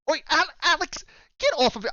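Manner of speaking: high Canadian shopkeeper scolding a pet; Canadian accent. Acted. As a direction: call off the beaver in a put-on Canadian accent. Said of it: "I am trying to do a Canadian accent".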